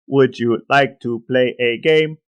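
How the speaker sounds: slow, robotic computer voice; computery voice. Retold or imitated. S1 quoting War Games to his brother, computer voice. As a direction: intone it like a cold machine. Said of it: "that computery voice that you just did".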